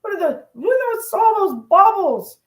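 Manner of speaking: a startled fish fretting at the divers; panicky shark voice. Retold or imitated. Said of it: "And the shark is going".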